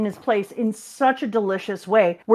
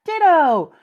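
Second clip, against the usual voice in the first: excited chirp; 8 semitones higher, 5 dB louder than usual. Retold or imitated. Acted eager reply.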